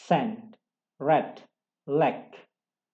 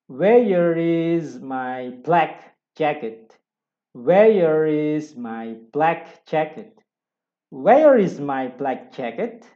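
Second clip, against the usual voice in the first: slow, loud model reading; 8 dB louder, much slower than usual. Shown as a demonstration. Teacher drilling the second example sentence, slow and loud. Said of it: "Yang kedua".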